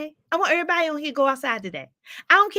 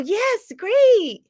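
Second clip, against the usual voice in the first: high bright cheer; 8 semitones higher, 5 dB louder than usual. Real, not acted. Host cheering a viewer's park walk, high. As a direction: cheer her on.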